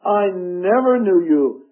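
slowed line with "never" as the strongest word; stress on never. Shown as a demonstration. Preacher repeating the verse to drive it home, stressing "never". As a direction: land firmly on "never", taking your time. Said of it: "Emphasis on the word never".

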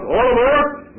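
high, loud call of all aboard. Retold or imitated. Conductor calling passengers onto the crowded coach, loud call. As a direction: sing it out like a train conductor.

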